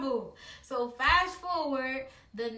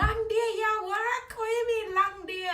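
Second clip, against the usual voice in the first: mock indignant mom; 10 semitones higher, 5 dB louder than usual. Mother scoffing at daughter's long day, mild mock indignation. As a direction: scoff like a mom hearing an excuse. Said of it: "She was like".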